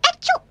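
high sneezing sound; a sudden squeaky sneeze. A narrator's reading. Mouse sneezing in the straw bed.

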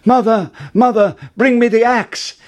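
high, loud shout for help; urgent calling out. A narrator's reading. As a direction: call down to Mother in a hurry. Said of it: "called out".

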